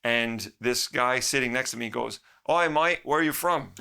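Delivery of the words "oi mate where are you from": put-on a light Australian accent, a bit louder. Man in the spa lobby greets him, mock Australian accent. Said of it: "It's a fucking Australian accent".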